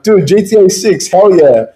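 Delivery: playfully hyped fanboy; mock excited. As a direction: cheer like a stoked dude. Gamer oblivious to war, cheering.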